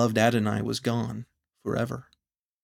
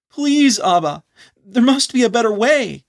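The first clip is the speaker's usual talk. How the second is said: high, loud, desperate pleading; last plea; 10 semitones higher, 8 dB louder than usual. Acted. Anon making one last plea to Abba, high and desperate. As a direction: throw everything into one final plea. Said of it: "make one last plea".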